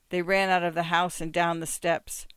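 ordinary delivery, nothing marked

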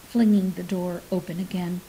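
plain reading voice

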